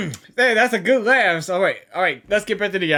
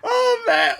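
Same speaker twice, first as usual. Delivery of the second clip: laughing; 11 semitones higher than usual. Real, not acted. Laughing at the nicknames.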